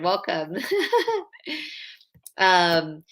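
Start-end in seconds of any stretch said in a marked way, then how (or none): 0.6-1.2 high giggle. Real, not acted. Laughing at crying.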